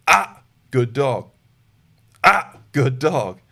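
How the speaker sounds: loud slow "Ah" then warm "good dog"; loud "Ah" turning into praise; quick switch correction to praise. Shown as a demonstration. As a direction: snap from pressure straight into praise. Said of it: "It can look like that".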